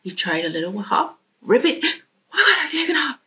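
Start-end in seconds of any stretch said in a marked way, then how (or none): 1.8-3.2 high, thrilled realisation; excited discovery. Acted. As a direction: burst out with sudden joy. Frog realises he can hop.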